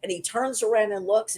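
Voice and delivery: ordinary delivery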